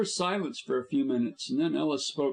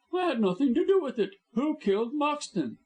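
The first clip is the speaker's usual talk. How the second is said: fast, high, louder protest; fast panicked denial; panicky denial; 13 semitones higher, 3 dB louder than usual. A narrator's reading. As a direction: blurt it out in panic. Panicked blackmailer.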